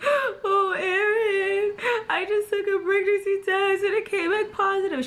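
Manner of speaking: high, loud wailing sob; mock crying. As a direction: wail it out like a hammed-up crying phone call. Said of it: "I'm just crying and crying".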